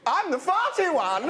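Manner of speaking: high, silly prince voice; Edward. Acted. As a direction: chirp it as the daft youngest brother. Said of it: "I'm Edward".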